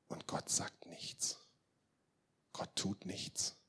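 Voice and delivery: slow, near-whispered statement; hushed gravity. Real, not acted. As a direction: drop to a slow whisper and let it hang. Dwells on God's silence. (langsam, fast geflüstert)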